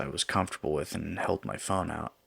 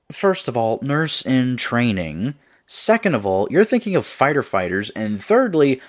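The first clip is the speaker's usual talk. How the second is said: loud, higher, indignant point-by-point protest; building outrage, louder and higher; indignant rebuttal; 6 semitones higher, 9 dB louder than usual. Acted. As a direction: count off objections, voice climbing. Acted quarrel, indignant listed rebuttal.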